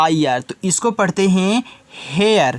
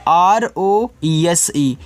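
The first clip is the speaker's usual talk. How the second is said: slow spelling out; much slower than usual. Shown as a demonstration. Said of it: "तो इसको पढ़ते हैं रोज".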